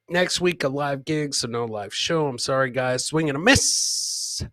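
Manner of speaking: ordinary talk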